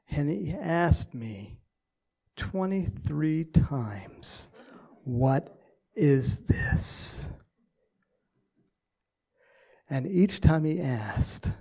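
slow, measured reading with weighty pauses; diary reading. Acted. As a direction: read the father's diary entry slowly, letting it sink in. Reads out father's diary.

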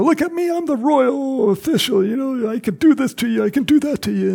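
high-pitched, hammed-up self-important whine; mock pompous official. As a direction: play a panicky official pulling rank. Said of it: "he didn't get all panicky".

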